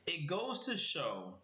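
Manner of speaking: slow, measured correction after rushing; slow right down and space the words; careful, unhurried restart; deliberately slowed down. Real, not acted. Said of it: "definitely speaking too fast".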